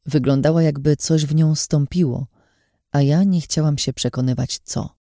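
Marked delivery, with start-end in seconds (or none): none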